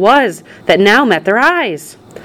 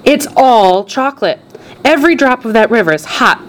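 higher, proudly gushing showman; excited showman; 6 semitones higher than usual. A narrator's reading. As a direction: show it off with great excitement. Showman shows off chocolate river.

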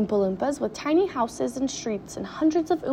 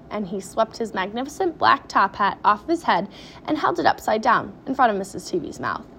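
ordinary delivery, nothing marked

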